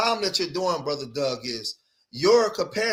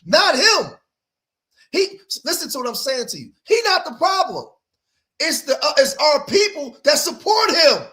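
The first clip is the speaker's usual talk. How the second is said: rising preach; 9 semitones higher, 6 dB louder than usual. Real, not acted. Preaching emphasis to audience.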